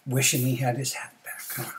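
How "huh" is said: ordinary delivery, nothing marked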